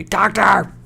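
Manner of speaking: high sharp call for the Doctor!. Shown as a demonstration. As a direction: shout Doctor! short and quick. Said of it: "Shorter, quicker".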